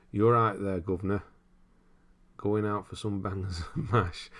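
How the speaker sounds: East End London accent, unconvincing. Shown as a demonstration. Streamer trying a London accent for a chat request. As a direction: have a go at a Cockney London accent. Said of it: "Try a London accent".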